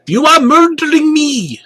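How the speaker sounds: shrill victim's yell. A narrator's reading. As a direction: cry out like you're being killed. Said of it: "One of them even yells".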